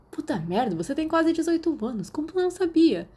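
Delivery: high, rising, loud mock disbelief; teasing disbelief. A narrator's reading. Sirius mocks Remus for not knowing, high and loud. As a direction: rib him for not knowing.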